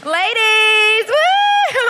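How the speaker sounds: high, loud whoop to the crowd; long, excited call and woo; cheering shout. Real, not acted. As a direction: hail the audience like party friends. Calling out to crying audience members.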